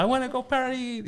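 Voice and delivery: put-on very high, giddy child voice; excited kid. Dad imitating his son wanting to party. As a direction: squeal it like a toddler. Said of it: "then he's like".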